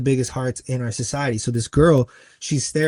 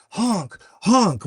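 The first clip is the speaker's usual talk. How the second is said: higher-pitched imitation of an upset protester; retold urgent plea, a touch worked up; emotional pleading; 7 semitones higher, 3 dB louder than usual. Upset girl with a sign begging cars, emotional. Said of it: "getting pretty emotional".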